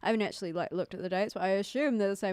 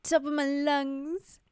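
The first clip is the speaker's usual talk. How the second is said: raised pitch, slow and drawn out; mock yelling; 5 semitones higher, 4 dB louder, much slower than usual. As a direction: playfully call it out higher. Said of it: "I just start yelling".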